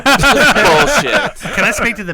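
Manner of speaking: slow, high, loud demanding voice; entitled customer. Pretend. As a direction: drawl it like a demanding shopper. Karen meme mid-joke.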